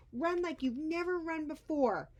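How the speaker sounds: high, loud rally cry; loud urging. Acted. Edwin urges the Wiggleskins on, loud. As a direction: drive them on.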